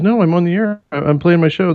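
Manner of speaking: high-pitched, insistent mock protest; retold insistence. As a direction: imitate a host insisting to doubters. Retold host insisting she's on air.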